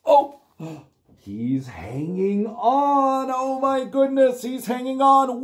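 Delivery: high, slow dramatic surprise; mock gasp. Host reacting to the clinging monster, high mock gasp. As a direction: gasp in delight at the stubborn monster.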